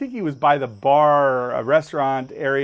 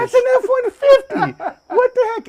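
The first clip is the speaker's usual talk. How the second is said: very high, loud mock outcry; astonished onlookers; 7 dB louder than usual. Boater acting out onlookers stunned by the truck, astonished. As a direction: imitate bystanders yelling in disbelief.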